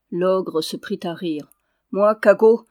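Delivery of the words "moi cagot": narrated ogre's outburst, much louder; loud scoffing exclamation. A narrator's reading. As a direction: exclaim it loudly, as the ogre in a tale. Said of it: "s'écria-t-il". (exclamation forte et moqueuse)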